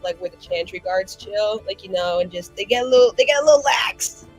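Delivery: usual speaking voice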